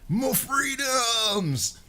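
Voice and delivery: long, high, drawn-out American holler; mock American accent. Shown as a demonstration. Streamer impersonating an American, hammed-up high drawn-out American cry. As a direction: bellow it as an over-the-top American patriot. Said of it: "if somebody asks me to impersonate an American".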